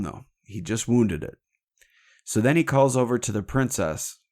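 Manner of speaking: ordinary delivery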